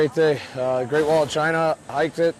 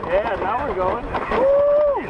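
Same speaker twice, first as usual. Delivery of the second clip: excited shouting; slower than usual. Real, not acted. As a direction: yell with thrill on the way down. Racing down the toboggan run.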